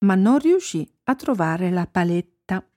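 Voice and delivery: usual speaking voice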